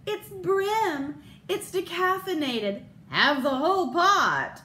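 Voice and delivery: hammed-up happy housewife; cheery wife. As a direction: beam it like a TV commercial wife. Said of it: "She says".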